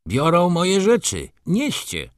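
brisk servant in command, pitch lifted high. A narrator's reading. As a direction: order the porters about. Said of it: "komenderował Leon".

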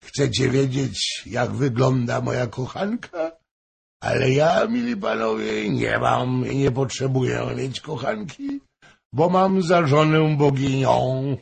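put-on the flushed drunk bragging about his wife; tipsy boastful courtier. A narrator's reading. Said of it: "Lice mu zaszło purpurą". (lekko podchmielony, chełpliwy dworzanin)

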